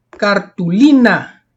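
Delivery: cartulina slowly, with a long strong LI; stress on second-last syllable. Shown as a demonstration. Teacher asking what type cartulina is, stressed LI. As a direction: stretch and punch the LI in cartulina. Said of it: "es una palabra grave".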